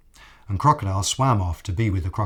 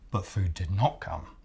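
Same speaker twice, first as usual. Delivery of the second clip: ordinary delivery, nothing marked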